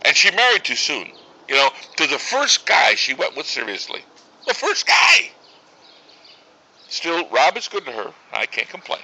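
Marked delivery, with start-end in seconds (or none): 4.5-5.3 high, loud cry of disbelief; exasperated outburst. Acted. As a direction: exclaim it like an outraged parent. Repeats it in exasperation.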